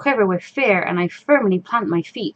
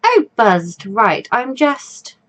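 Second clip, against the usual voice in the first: ordinary talk, nothing marked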